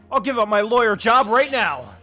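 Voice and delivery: loud courtroom declaration; dramatic shout. Acted. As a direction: belt it like a lawyer. Lawyer's dramatic vow.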